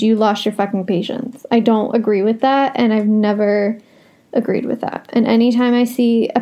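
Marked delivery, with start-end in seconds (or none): none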